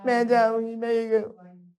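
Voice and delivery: loud, high mock tantrum whine; whining child. Little girl refusing to listen to her father, whining. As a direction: imitate a child wailing insistently. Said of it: "ये ऐसे कर रही".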